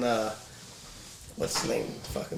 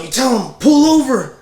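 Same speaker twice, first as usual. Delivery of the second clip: rising to a loud, high shout on pull over; joke character yelling; 9 semitones higher, 5 dB louder than usual. Retold or imitated. Joke's passenger urging the driver, loud high yell. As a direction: egg him on, then bark the order. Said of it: "He's all, he's all".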